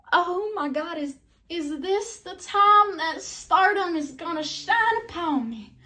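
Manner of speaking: excited inner thought. Retold or imitated. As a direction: gush about your big break. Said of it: "I was so excited".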